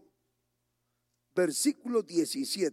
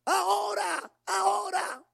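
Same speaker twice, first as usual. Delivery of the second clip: crying out to God; 12 semitones higher, slower than usual. Retold or imitated. Sick brother begging God at the altar, crying out. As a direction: plead now, voice breaking. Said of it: "Así clamaba".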